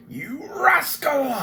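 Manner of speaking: high strained hissed yell; mock roar. Flint at the kender who took his pouch, hissed roar. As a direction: rage at the pickpocket. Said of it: "he roared".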